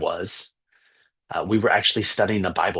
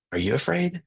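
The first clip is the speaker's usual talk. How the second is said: hushed question; 9 semitones higher than usual. Retold or imitated. Worried student leans over to ask the teacher, hushed rising question. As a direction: ask it gently higher, lifting to the end.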